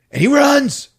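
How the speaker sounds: high, loud burst of storytelling; urgent narration. A narrator's reading. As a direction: punch it out as the chase starts. Excited telling of the chase.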